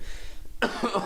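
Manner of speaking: harsh choking cough; coughing fit. Real, not acted. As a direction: cough on a drink gone down the wrong way. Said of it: "that went down the wrong hole".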